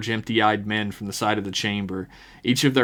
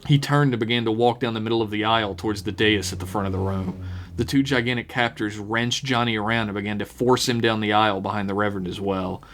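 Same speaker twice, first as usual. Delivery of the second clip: ordinary delivery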